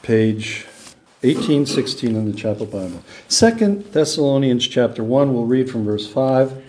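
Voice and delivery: ordinary talk, nothing marked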